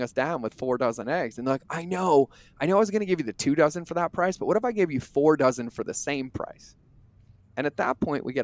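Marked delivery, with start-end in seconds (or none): 1.7-6.8 high and fast, overeager pitch; eager pushy clerk. Retold or imitated. Clerk chasing shoppers to the car, fast eager pitch. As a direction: rush the hard sell like a desperate clerk.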